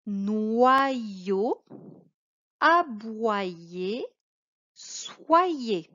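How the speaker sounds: careful model reading of a word list; reading aloud. Shown as a demonstration. Teacher reading words together with pupil. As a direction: read each word clearly, one at a time. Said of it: "on les lit ensemble".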